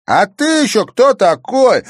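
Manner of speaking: high, loud, slow demand; irritated challenge. A narrator's reading. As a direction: challenge a stranger with open annoyance. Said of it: "раздражённо поинтересовался Бельцев".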